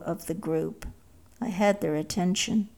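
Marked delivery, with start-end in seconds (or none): none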